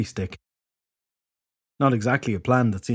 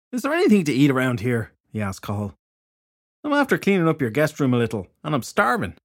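lightly voiced blunt detective, a bit higher; hungry fairy detective; 4 semitones higher than usual. A narrator's reading.

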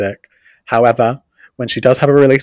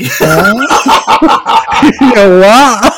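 laughing; 10 semitones higher, 8 dB louder, much slower than usual. Real, not acted. Laughing after brain fade.

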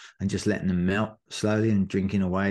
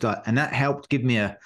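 ordinary talk, nothing marked

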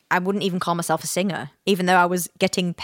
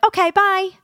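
chirpy goodbye; 13 semitones higher, 3 dB louder than usual. Retold or imitated. Chirpy goodbye on phone call.

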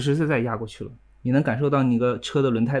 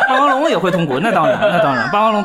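laughing while talking; words bubbling with laughter; 4 semitones higher, 5 dB louder than usual. Real, not acted. Laughing along at dinosaur joke.

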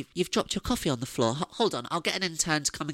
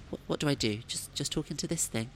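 ordinary talk, nothing marked

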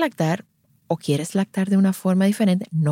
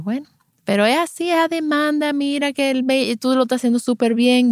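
higher, bright reassuring push; pep up the new mom, higher pitched; upbeat encouragement; 7 semitones higher than usual. Retold or imitated. Consultant encourages the overwhelmed mother, upbeat and higher.

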